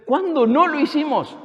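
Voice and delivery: high, loud indignant protest; indignant goats protesting. Retold or imitated. The goats protest to the Lord, high and loud. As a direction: cry out in offended denial. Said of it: "las cabras que dicen".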